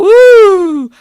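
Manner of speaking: loud high ghost woo; ghostly woo. Shown as a demonstration. Mocking the stock ghost noise, loud woo. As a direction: hoot it like a ghost.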